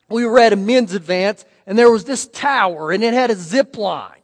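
normal talking voice

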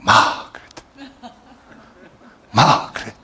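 soft seductive whisper of a name; tempting whisper. Retold or imitated. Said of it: "the spirit of Satan would say".

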